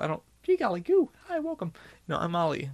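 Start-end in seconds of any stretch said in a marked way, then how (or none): 0.4-1.7 high-pitched mock chirpy greeter; put-on high, loud gee-golly voice; fake gee-golly cheer. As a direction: parody an over-eager, sing-song welcome. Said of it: "I don't fucking gee golly".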